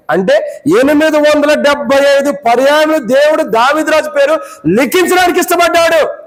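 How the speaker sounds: high-pitched, insistent preaching. Acted. (ఎత్తైన స్వరంతో ప్రసంగం)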